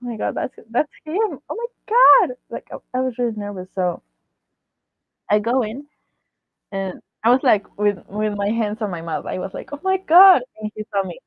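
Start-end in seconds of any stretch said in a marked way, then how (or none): 0.0-2.4 high, amazed re-enacted outburst; retold fangirl amazement; starstruck disbelief. Reliving first sight of the star. 9.7-10.4 high, loud awed exclamation; re-enacted fangirl astonishment; starstruck shock. Reliving awe, hands on mouth.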